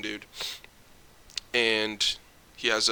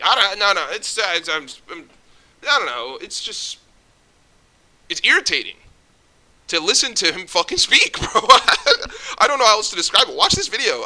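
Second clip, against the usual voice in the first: higher and louder, worked-up rant; exasperated rant; 8 semitones higher, 7 dB louder than usual. Real, not acted. Vlogger ranting about a podcast guest, exasperated and loud. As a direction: vent real irritation, voice climbing. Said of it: "it's infuriating".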